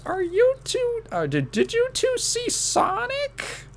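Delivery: put-on very high, drawn-out older lady voice; older woman. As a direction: put on an excitable old lady's falsetto. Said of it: "this older woman sees us".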